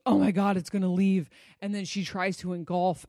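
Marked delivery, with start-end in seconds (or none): 0.1-1.3 louder, alarmed outburst in imitation; hammed-up fear of being left; mock panic. Feminine energy panicking that he will leave, louder mock alarm. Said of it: "the feminine energy goes".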